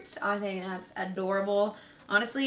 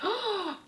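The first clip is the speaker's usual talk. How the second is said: excited gasp; 11 semitones higher, 4 dB quieter than usual. Real, not acted. Finds a fun dress.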